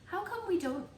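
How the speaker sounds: put-on a kid pestering his grandma; young boy CJ. CJ asks about a car.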